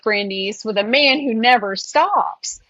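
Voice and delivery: ordinary talk, nothing marked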